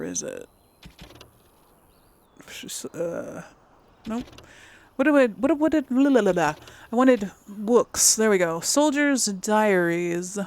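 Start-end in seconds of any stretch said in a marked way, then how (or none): none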